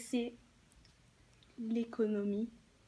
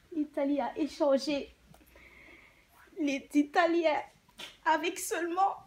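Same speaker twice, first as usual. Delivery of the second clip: indignant outburst; 7 semitones higher, 4 dB louder than usual. Acted. Widow's outrage over the exchange.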